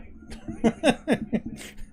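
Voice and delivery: high, unvoiced snickering laugh; a quick real giggle; breathy giggle. Real, not acted. Fan laughing at his TikTok threat, high giggle.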